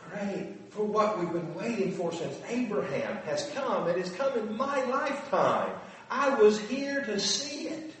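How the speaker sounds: thrilled, barely believing it; excited joy. Retold or imitated. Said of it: "For them just to go".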